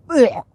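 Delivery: loud retching "ugh"; dry retch. A narrator's reading. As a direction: gag in disgust on a bad bite. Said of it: "then he dry bulked".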